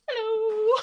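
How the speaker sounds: mock-bright greeting to a startled child; awkward hello. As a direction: chirp it out, painfully awkward. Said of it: "peak awkwardness and went".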